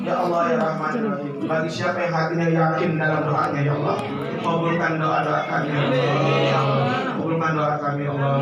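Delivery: low, earnest prayer voice. Acted. Preacher leading the congregation in prayer, low and earnest. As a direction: pray it out deeply to Allah.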